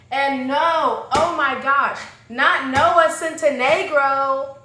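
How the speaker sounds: loud, drawn-out theatrical protest; hammed-up groaning outburst; mock dismay. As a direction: exclaim in exaggerated disbelief. Mock outburst at actor's appearance.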